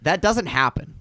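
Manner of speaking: ordinary talk, nothing marked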